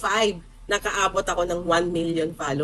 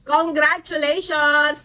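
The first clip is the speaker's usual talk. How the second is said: excited congratulations; 8 semitones higher, slower than usual. Real, not acted. Greeting viewers at year end.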